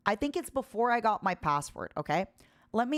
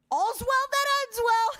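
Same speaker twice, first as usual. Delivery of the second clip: triumphant; 12 semitones higher, 4 dB louder than usual. Real, not acted. Triumphant wrap-up of phone saga.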